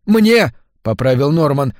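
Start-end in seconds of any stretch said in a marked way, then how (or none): 0.1-0.5 high, loud correction; breaking into a shout. A narrator's reading. Husband corrects his wife, breaking into a shout. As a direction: burst out, pitch jumping up. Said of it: "сбиваясь на крик".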